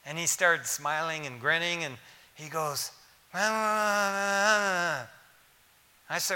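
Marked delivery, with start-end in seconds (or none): 3.3-6.1 long wordless drone of a man trying to talk; drawn-out muffled "aah", higher than usual; imitated muffled moan through oxygen mask. Dying dad answering through his oxygen mask, muffled moaning. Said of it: "pulled the oxygen, oxygen thing off".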